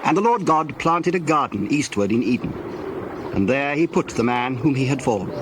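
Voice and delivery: usual speaking voice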